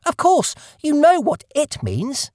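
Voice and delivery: high, slow and testy; cross mouse. Acted. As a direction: talk down to the duck impatiently. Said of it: "rather crossly".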